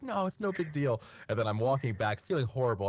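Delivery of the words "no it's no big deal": higher-pitched retold reassurance; brushing it off. Hit fan shrugging it off to the thrower, higher-pitched reassurance. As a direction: play it down as the poor guy who got hit. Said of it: "he was just like".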